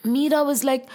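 ordinary delivery

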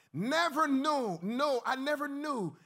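high, loud, drawn-out amazement; emphatic disbelief. Real, not acted. Speaker recalling his chance to meet her, emphatic disbelief. Marvels at meeting his heroine.

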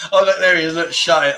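ordinary talk, nothing marked

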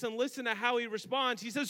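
normal talking voice

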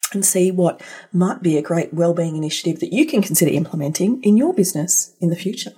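ordinary delivery

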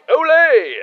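high, loud triumphant olé; shouted olé. Retold or imitated. [name] retelling how he shouted at the end of the tango. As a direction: belt it out at the dance's end. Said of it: "I shouted".

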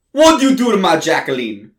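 put-on loud Italian-accented father; a protective Italian dad, loudly. Angry father at the door, in an Italian accent. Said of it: "speaks with an Italian accent".

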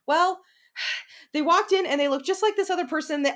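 upset inner self. Retold or imitated. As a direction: blurt it out with a sigh. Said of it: "So I'll be like".

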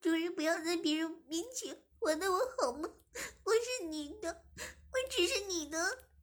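crying. Acted. Zhimin cries to the master not to shame her in public, high tearful plea. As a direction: plead through sobs, high. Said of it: "志明哭着说".

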